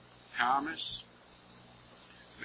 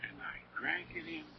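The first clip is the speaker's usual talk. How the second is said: a hushed, faster whisper of the repeat; 16 dB quieter, faster than usual. Shown as a demonstration. Preacher reliving his wonder to the flock, whispered. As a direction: breathe it out as if still savoring.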